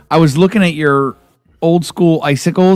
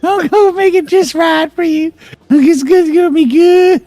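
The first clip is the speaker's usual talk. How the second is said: much higher, wheedling creepy cook; mock creepy voice; 12 semitones higher than usual. Imitating the Scary Movie guy pushing mashed potatoes, higher. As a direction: coax like a creepy cook offering food. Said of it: "he was more like this".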